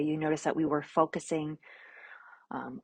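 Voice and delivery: ordinary talk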